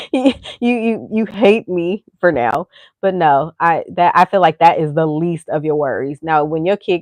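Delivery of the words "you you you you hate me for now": mock patronizing. Mock talk to hating kid.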